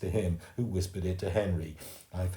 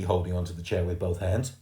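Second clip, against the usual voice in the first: ordinary delivery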